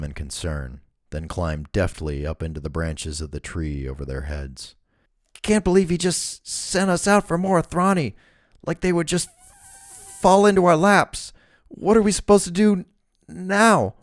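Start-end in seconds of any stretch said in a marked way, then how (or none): 5.4-13.9 high, whiny henchman voice; high-pitched man. A narrator's reading. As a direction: squeak it out like a nervous thug. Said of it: "The voice was high-pitched".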